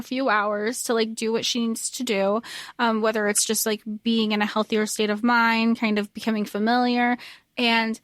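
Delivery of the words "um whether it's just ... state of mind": usual speaking voice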